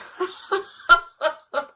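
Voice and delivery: high cackling laugh of a prankster; mocking prank laugh. Jones laughing at his scared friends, mocking cackle. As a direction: burst out laughing at them. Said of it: "Jones busted up laughing".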